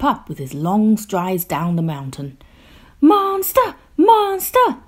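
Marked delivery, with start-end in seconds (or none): 3.0-4.8 high, loud excited calls; cheering. A narrator's reading. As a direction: cheer and call after him. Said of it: "they cheered after him".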